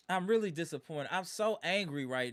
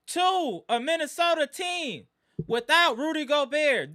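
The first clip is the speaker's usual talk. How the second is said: angry loud rant; 5 semitones higher, 8 dB louder than usual. Real, not acted. Fan rants about blowout loss.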